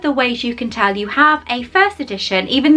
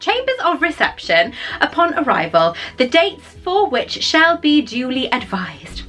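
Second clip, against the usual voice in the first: a stately, slightly raised reading voice; formal letter reading; 4 semitones higher than usual. Acted. Vlogger reading her Hogwarts acceptance to viewers, formal and lofty. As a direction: read it like an official letter, with ceremony.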